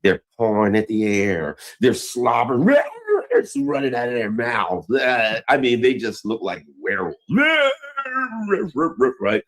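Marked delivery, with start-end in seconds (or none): 2.8-3.3 a sudden squeaky laugh; high burst of laughter. Real, not acted. Describing slobbering police dogs, a sudden high laugh. 7.2-9.1 long rising laugh; drawn-out high laugh. Real, not acted. Comparing police dogs to werewolves, a long high laugh.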